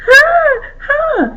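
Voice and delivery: two high, hiccuping sobs; high sobbing gasps. Acted. Crying girl ignoring the fox's story, sobbing gasps. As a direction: sob in sharp little catches. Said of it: "continued to cry".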